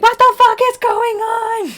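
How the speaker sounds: high, slow furious yell; furious outburst. Retold or imitated. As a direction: blow up in frustration. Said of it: "me being like".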